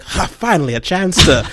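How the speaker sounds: mock-grand actor's exclamation; imagined eager actor. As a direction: play a thespian relishing a grand role. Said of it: "I think he saw, like, Dracula on the script, like".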